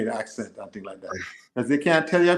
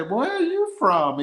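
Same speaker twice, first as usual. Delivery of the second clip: high, drawn-out mimicked American question; exaggerated nosy American voice; mocking American questioner; 10 semitones higher, slower than usual. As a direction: imitate a teasing American, slow and high. Imitates Americans mocking his accent.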